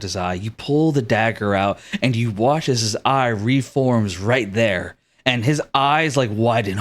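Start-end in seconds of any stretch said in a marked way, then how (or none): none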